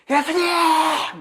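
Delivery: high, drawn-out desperate howl; howling cry. Retold or imitated. Lepers howling to Jesus from afar, desperate loud cry. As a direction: cry out like a wounded animal. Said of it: "거의 짐승처럼 울부짖었을 거라고".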